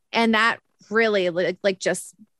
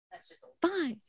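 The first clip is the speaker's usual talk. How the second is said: much softer, pitched-up sarcastic mutter; sarcastic fine; 7 semitones higher, 12 dB quieter than usual. Retold or imitated. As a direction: fake acceptance through the teeth. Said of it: "so I was like".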